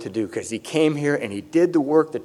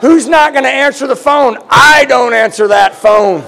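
loud, higher, slowing on the last line; comic shout; 9 semitones higher, 9 dB louder than usual. Real, not acted. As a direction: shout the joke out to the room. Joking rant to the audience.

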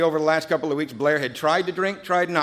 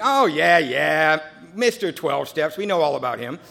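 knowing sailor; 3 semitones higher, 6 dB louder, slower than usual. Retold or imitated. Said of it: "on duty said".

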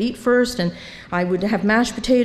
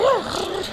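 a sharp, high mock gasp; imitated gasp; 9 semitones higher than usual. Neighbour at the meeting, shocked: a mock gasp. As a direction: gasp like the startled guy beside her. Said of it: "he was like".

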